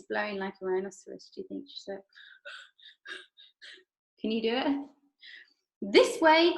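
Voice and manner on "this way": high, loud call. Acted. Mister Wonka calling the party down the stairs, high and loud. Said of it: "cried Mister Wonka".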